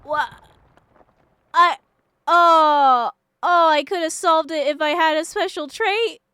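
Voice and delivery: loud high disbelieving outcry; loud anguished disbelief; outraged cry. Real, not acted. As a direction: cry out at the unfair result. Cries out at missed outcome.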